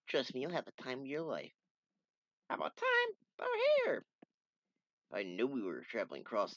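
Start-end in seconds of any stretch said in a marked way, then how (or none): 2.5-4.0 very high, loud call; squeaky shout to get attention. Acted. Character voiced by reader.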